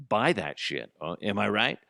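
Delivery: ordinary delivery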